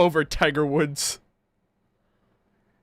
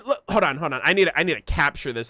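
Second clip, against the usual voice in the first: usual speaking voice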